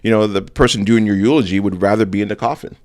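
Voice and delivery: ordinary talk, nothing marked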